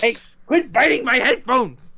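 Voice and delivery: high, laughing yell at the cat; laughing shout. Real, not acted. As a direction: yell it while laughing, slowly. Cat biting headphones.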